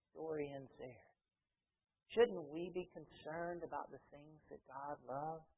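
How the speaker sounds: normal talking voice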